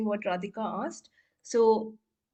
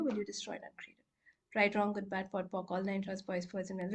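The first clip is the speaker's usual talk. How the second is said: low-key, quick rote recital; soft, practised rote formula; clearing statement recitation; 3 semitones lower, 8 dB quieter than usual. Real, not acted. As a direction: rattle it off softly, trailing quieter.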